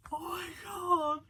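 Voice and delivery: high-pitched, emotional oh my god; overwhelmed exclamation. Real, not acted. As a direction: voice jumps up, overcome by feeling. Overwhelmed by character's pain.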